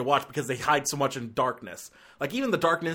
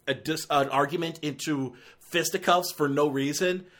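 ordinary talk, nothing marked

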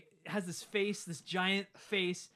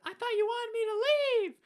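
very high, louder wounded voice; mock mom; 7 dB louder than usual. Mom answering dad in the tornado, hammed-up hurt. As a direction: squeak it out like a melodramatic mom.

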